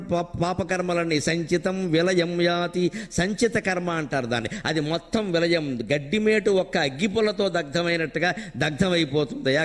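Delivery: ordinary delivery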